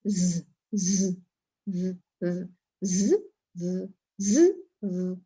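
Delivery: repeated ز and ذ, tongue moving between teeth and ridge; contrasting z sounds. Shown as a demonstration. Teacher contrasting the z of زبان with Arabic ذ. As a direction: drill ز against ذ. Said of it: "فرق ہے".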